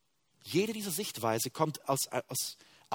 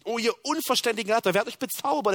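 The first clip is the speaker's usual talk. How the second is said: loud, fast and much higher scolding; sharp rebuke; 8 semitones higher, 6 dB louder, much faster than usual. Shown as a demonstration. Preacher voicing Paul sharply rebuking the Galatians, loud and fast. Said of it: "er verurteilt es so scharf".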